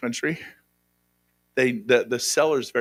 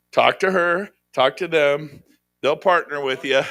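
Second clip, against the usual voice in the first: ordinary talk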